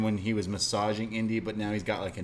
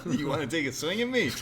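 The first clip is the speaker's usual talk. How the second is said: laughing imitation; 5 semitones higher, faster than usual. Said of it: "Chase Parker who says this all the time".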